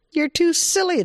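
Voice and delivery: very high, silly rabbit voice; teasing rabbit. Acted. Giggling rabbit tells Bobcat he is too silly, high teasing. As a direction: tease from way up high.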